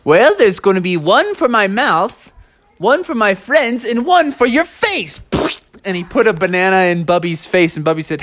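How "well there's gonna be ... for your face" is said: high character voice, climbing to the end; Monk Master. Acted. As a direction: build up playfully to the last phrase. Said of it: "And Monk Master said".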